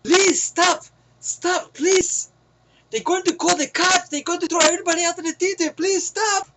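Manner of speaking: high-pitched mock pleading; imitated pleading bystander. Chinese moviegoer begging the fighters to stop, high-pitched pleading. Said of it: "the guy came out there".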